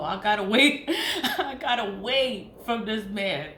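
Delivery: laughing through the words; breaking into real laughter mid-line. Real, not acted. Laughing at the idea of waiting on this man. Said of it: "And I'm like".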